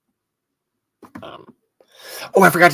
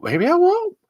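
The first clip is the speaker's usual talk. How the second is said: same words jumped way higher with a rising end; squeaky high repeat; 13 semitones higher than usual. Acted. Host repeating his joke about dying in a high squeak. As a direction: squeak it up in mock worry.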